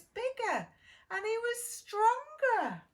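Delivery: triumphant build. A narrator's reading. Narrator reaching the climax, triumphant and loud. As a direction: swell with pride on the last word.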